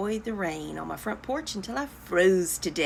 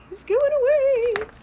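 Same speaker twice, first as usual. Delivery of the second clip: much higher, louder dismay; a hammed-up cry of loss; higher mock wail; 5 dB louder than usual. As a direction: push the second take up into a wail. Repeat, even higher.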